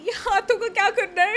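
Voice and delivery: high, loud, rushed bewildered exclamation; mock baffled cry. A speaker recalls her first time on stage, bewildered and rushed. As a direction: squeal it in mock panic, fast. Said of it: "I think when to first time हमें said".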